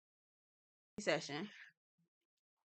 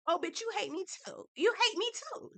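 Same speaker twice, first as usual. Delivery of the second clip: high realization; 9 semitones higher than usual. Retold or imitated. Realizing in retold argument.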